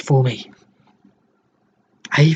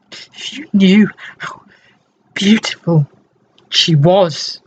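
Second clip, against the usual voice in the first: rapturous anguish; 4 semitones higher than usual. Acted. Dying man recalls his love.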